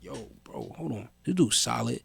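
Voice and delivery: near-whispered inner thought; hushed, under the breath. Retold or imitated.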